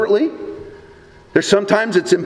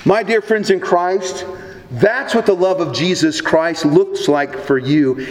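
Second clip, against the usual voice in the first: ordinary talk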